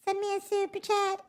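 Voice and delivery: very high put-on squeak; silly squeaky voice. Streamer asks viewers for a super chat, silly squeaky voice. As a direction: pitch it way up, playfully.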